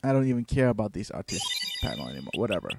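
quiet, fast, giving up; resigned. Real, not acted. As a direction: mutter in defeat.

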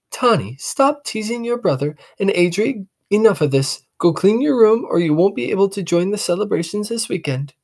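high, firm mother; mother laying down the law; mother scolding both kids; scolding mom. Acted.

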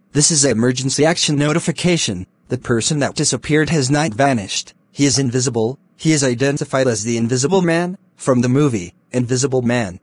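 usual speaking voice

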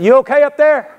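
high-pitched call up to someone overhead. Retold or imitated. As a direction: holler it up into an attic. Calling up to man in attic.

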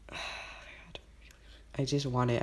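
hushed, voiceless plea; nervous whisper. Real, not acted. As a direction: whisper a desperate wish under your breath. Hushed wishing as the egg hatches.

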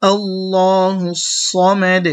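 আল্লাহু drawn out long and melodic; slow recited Allahu. Shown as a demonstration. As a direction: stretch আল্লাহু slowly, recitation style. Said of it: "আবার বলি".

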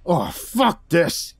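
slow, strained swearing; fed-up fighter's drawn-out curse; exasperated curse. A narrator's reading. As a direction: drag it out in frustration. Fighter fed up, tossed aside.

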